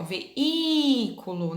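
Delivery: veículo slowly, stressed í open and long. Shown as a demonstration. Teacher showing pupils the open í in veículo. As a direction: draw out the í in veículo. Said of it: "esse i é o som bem aberto".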